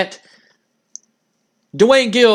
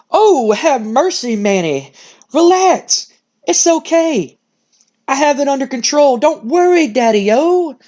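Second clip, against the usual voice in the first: happy-go-lucky, higher swinging voice; upbeat, laid back; 5 semitones higher than usual. Acted. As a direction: soothe a worrier like a happy, laid-back cool cat. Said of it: "very upbeat, very happy and laid back".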